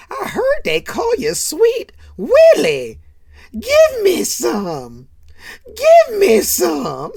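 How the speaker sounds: very high, loud, slow holler; hammed-up goading. Character at dinner egging on Sweet Willie, slow high shout. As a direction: stretch each word into a comic yell. Acted lewd goading at the table.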